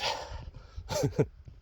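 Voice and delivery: a high, soft, unvoiced giggle; breathless laugh. Real, not acted. Laughing at how annoyed he got, soft giggle. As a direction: giggle under your breath. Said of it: "I'm laughing".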